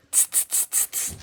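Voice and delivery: soft unvoiced tsk-tsk; cheeky hissing monkeys; teasing monkeys. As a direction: monkeys tutting back at the peddler. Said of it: "the monkeys only stomped their feet at him and said".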